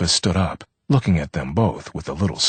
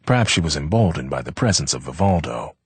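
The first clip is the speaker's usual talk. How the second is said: usual speaking voice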